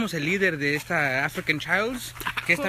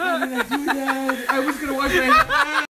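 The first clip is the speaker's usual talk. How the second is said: laughing in pain; 7 semitones higher, 3 dB louder than usual. Real, not acted. Eyes burning from chili.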